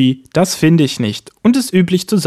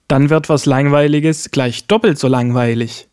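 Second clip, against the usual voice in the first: ordinary delivery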